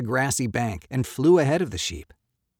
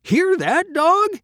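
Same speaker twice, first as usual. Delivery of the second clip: loud, high bellow to the dog; farmer's bellow; 8 dB louder, slower than usual. A narrator's reading. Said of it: "he bellowed".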